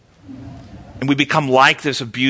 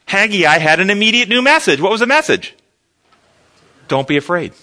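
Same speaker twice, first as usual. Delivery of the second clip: ordinary talk, nothing marked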